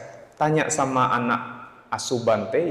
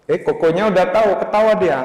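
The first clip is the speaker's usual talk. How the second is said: amused, fast; 7 semitones higher, 6 dB louder, faster than usual. Retold or imitated. Koko laughs knowingly.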